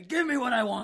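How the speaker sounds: very high, loud whiny plea; hammed-up wrestler begging; Batista voice. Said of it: "He keeps saying".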